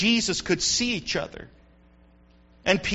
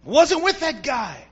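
emphatic denial; 4 semitones higher, 4 dB louder, slower than usual. Acted. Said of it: "Peter gets even more emphatic".

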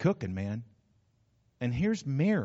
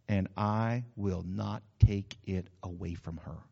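gentle rebuke; 6 semitones lower, slower than usual. Acted. Jesus answering Martha gently.

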